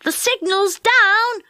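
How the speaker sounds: high, slow calling out; boy yelling from the engine cab; excited yell. Acted. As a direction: shout the news to Joe. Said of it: "yelled Dick".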